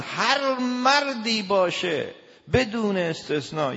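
ordinary talk

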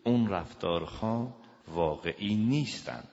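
ordinary talk, nothing marked

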